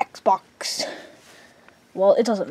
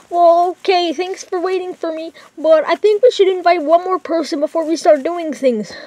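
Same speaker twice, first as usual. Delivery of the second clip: nervous friend voice; 8 semitones higher, 4 dB louder than usual. Acted. Friend worries on the phone about mom, high nervous voice. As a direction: fret about the mom coming home in a high voice.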